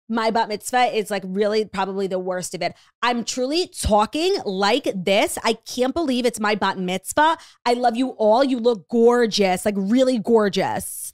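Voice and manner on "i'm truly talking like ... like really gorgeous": slow, stretched Long Island vowels, hammed up; thick Long Island accent. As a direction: imitate your younger self in a thick, drawn-out Long Island accent. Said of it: "really annoying Long Island accent".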